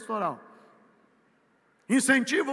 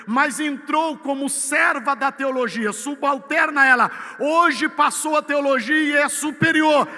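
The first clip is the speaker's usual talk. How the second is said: building louder and higher into a full shout; rising preaching; 5 semitones higher, 9 dB louder than usual. Real, not acted. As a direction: climb to a thundering sermon peak. (pregação que cresce até o grito)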